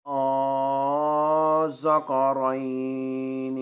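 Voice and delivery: very slow recitation with a long held opening vowel; Quranic recitation. Shown as a demonstration. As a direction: sustain the madd on a level note. (قرآنی تلاوت، لمبی مد کے ساتھ)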